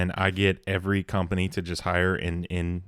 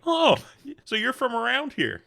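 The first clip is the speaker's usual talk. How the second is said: much higher, bright fake-friendly question; chirpy mock barber. Barber making small talk during a haircut, in a high mock-chirpy voice. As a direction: pop the "Oh" way up like an overeager barber. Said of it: "And it's like".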